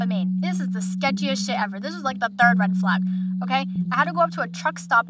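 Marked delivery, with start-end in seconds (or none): none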